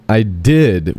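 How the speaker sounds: "did" stretched, swooping up then falling off; rising emphasis on DID. Retold or imitated. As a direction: lean heavily on "did", lifting the pitch, then let it drop. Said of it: "a rising inflection on did, heavy emphasis".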